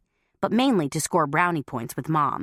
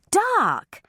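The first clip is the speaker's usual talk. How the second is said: high bright call across the room; 4 dB louder than usual. A narrator's reading. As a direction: call his name out, much higher and louder. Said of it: "Mom called out".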